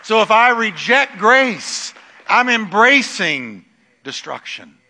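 loud, high preaching cry. Acted. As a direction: preach it out with full force. Preaching at full force.

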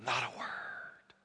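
imitating a reverent whisper; hushed whisper. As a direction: hush the whole hall in a whisper. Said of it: "she would say".

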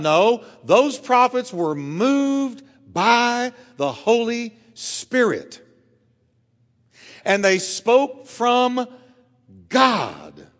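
preacher driving home Peter's verse; slow, intense preaching. Real, not acted. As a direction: draw the verse out, landing on God. Preacher building to a climax.

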